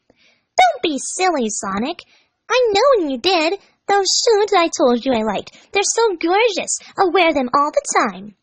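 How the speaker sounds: put-on high, slow gushing girl voice; Amy voice. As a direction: coo sweetly like a smitten girl.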